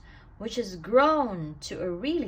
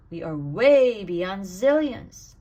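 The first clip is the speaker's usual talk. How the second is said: emphatic build; 8 dB louder than usual. A narrator's reading. Counting sleepers, building to a climax.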